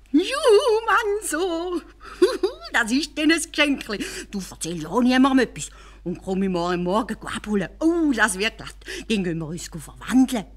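viel höher, lachend vor Freude; jubelnder Kalif. Acted. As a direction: ausgelassen jubeln wie ein Kind. (Kalif freut sich über das Geschenk, hoch und jubelnd)

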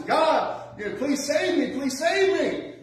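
high, loud desperate plea with a laugh; crying out to God. Retold or imitated. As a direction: beg God to save you from the waves. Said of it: "he's crying out to God".

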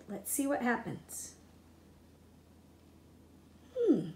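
normal talking voice

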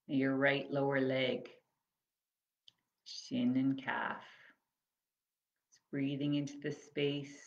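measured, spacious phrases that leave room to breathe; guided body scan meditation. Acted. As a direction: guide them gently through the body, unhurried.

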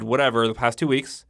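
ordinary talk, nothing marked

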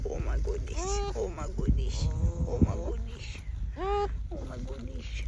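soft, half-whispered cooing. Real, not acted. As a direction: whisper it fondly.

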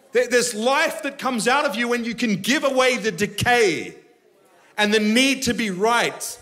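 fervent sermon climax; full-force preaching. Acted. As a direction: drive the point home to the crowd.

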